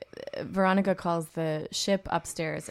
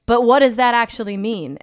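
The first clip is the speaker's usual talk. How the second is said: louder, higher, insistent demand; raised voice pressing for an answer; exasperated Riker; 4 semitones higher, 9 dB louder than usual. Acted. Riker to Troy about their relationship, exasperated. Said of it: "so he's like".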